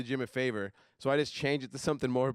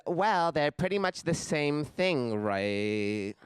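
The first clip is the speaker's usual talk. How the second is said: slow, ditzy girl impression; clueless chick; much slower than usual. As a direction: drag it out like an oblivious girl. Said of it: "And then she says".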